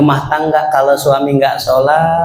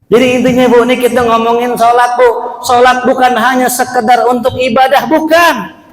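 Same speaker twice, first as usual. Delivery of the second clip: fervent preaching; 9 semitones higher than usual. Acted. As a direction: rise to the peak of the point. Preacher climax.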